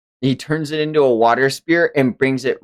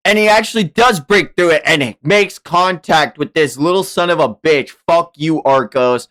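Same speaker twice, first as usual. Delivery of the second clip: louder and higher, racing with excitement; hyped narration; 5 semitones higher, 5 dB louder than usual. Real, not acted. Streamer hyping up the fight to chat, excited. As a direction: call the hit like a hype moment.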